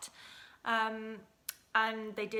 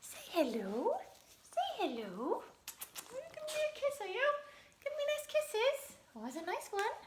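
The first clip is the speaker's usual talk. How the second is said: very high cooing pet talk; dog voice; 14 semitones higher, 3 dB quieter than usual. Real, not acted. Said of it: "That's my dog voice".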